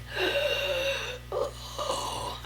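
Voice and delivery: a sharp, high, breathy gasp for air; imitated gasp. Injured boyfriend on the hospital phone, gasping weakly. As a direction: gasp like a hurt man struggling to speak. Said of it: "and he was like".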